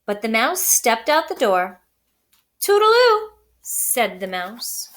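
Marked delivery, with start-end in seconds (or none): 2.6-3.3 cheery farewell. Acted. Mouse bids cheery farewell.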